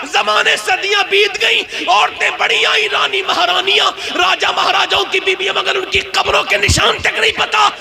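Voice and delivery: fiery climax of a sermon; preaching at full force. Acted. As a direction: proclaim it to the whole gathering. Preacher's climax about kings' graves.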